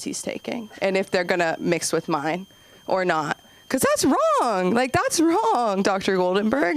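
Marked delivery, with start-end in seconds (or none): 3.7-5.8 much higher, slow drawn-out that's wrong; slow emphatic scolding. Real, not acted. Comic scolding Doctor Goldenberg, slow and emphatic. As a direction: lean hard on every word.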